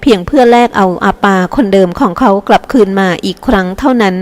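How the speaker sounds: plain reading voice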